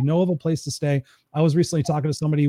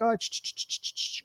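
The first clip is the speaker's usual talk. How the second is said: shushing; 8 semitones higher, 10 dB quieter than usual. Retold or imitated. Shushing the excited crowd.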